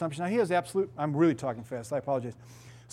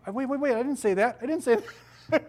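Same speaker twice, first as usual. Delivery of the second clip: higher, urgent mock protest; 6 semitones higher than usual. Pastor voicing himself stopping an argument, mock urgent. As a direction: hold up the argument, lightly hammed.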